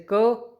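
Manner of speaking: क said light, with no puff of breath; teacher showing an unaspirated क; unaspirated demo. Shown as a demonstration. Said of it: "इनको बोलने में ज़्यादा जोर नहीं लगता".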